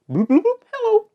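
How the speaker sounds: very high, squeaky puppet babble; tiny pretend voices bubbling up high; Marcus and Lexi. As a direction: squeak like little imaginary friends. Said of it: "they said hello back at you".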